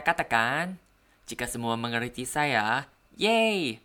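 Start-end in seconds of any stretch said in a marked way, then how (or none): 3.2-3.8 bright happy yeay; excited cheer. Real, not acted. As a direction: cheer it with a jump up. Happy that listeners understood.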